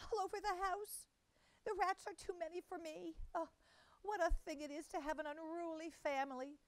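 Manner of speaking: high, tearful fretting mother-cat voice. A narrator's reading. Tabitha greets her cousin in tears, high and tearful. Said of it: "said Missus Tabitha Twitchit".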